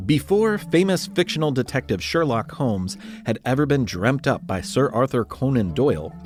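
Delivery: usual speaking voice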